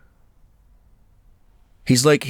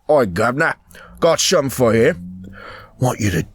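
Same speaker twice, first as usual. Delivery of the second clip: rough-and-ready mock Cockney; Cockney accent. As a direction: play a chummy Cockney caricature. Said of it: "The Cockney accent".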